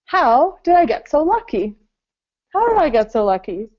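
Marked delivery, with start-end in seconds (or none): 0.1-1.8 excited. Shown as a demonstration. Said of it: "Second one".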